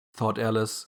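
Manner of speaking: usual speaking voice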